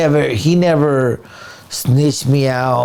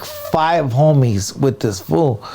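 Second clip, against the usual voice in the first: ordinary delivery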